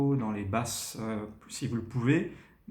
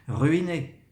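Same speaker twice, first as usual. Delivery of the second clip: "Ruiner" high and loud; stressed word; 4 semitones higher, 5 dB louder than usual. Shown as a demonstration. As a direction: hit "Ruiner". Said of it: "Donc je vais vite".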